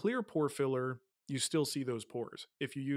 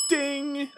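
high, loud sung-out "ding"; playful loud bell imitation; imitated bell ding; 12 semitones higher, 9 dB louder than usual. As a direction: ring it out like a game-show bell. Imitates scoring bell for offense.

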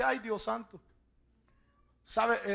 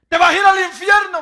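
mock warning shout; 7 semitones higher, 14 dB louder than usual. Warning the wanderer.

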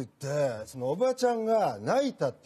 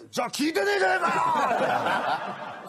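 high shouted comeback; mock angry shout; 9 semitones higher, much faster than usual. Old man shouting at the masseuse, mock angry. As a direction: fire it back, much higher.

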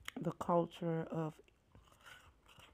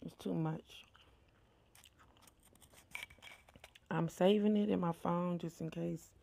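talking while chewing ice; cold food in mouth; mouth full of ice. Real, not acted. Seen 0: Spoons shaved ice into mouth at 1.0, then speaks while chewing it.

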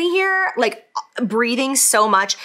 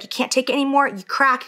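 usual speaking voice

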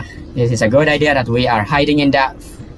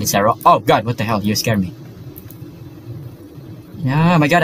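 ordinary delivery

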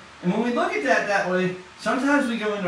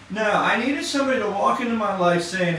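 usual speaking voice

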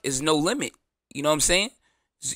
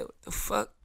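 soft, stunned "what the fuck"; shocked reaction; 5 semitones higher, 10 dB quieter than usual. Pretend. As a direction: catch it quietly in disbelief. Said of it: "I'll be like".

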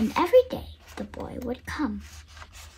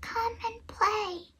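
slow, faint and higher; soft whisper; 7 semitones higher, 5 dB quieter, slower than usual. A narrator's reading. Tree too happy to speak, slow soft plea. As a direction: barely breathe it out. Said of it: "she whispered".

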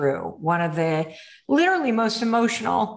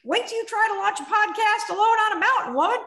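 mock her like a spiteful inner bully; nagging inner voice; 10 semitones higher, faster than usual. The inner critic taunting her about the mountain move, higher and faster. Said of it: "this fucking bitch shows up".

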